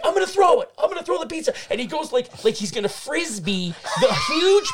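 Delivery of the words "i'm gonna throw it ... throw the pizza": much higher, louder frantic voice; a desperate guy about to throw; panicked threat imitated. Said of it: "He's like".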